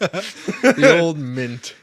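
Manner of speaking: slow laughing drawl. Pretend. Drawn-out joke line, laughing.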